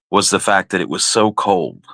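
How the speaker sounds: ordinary delivery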